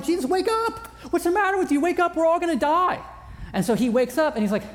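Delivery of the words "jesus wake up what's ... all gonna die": high mock-panicked cry to wake him; hammed-up fear, half laughing; panicked disciples. Disciples waking Jesus in the storm, mock panic. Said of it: "they go to him like".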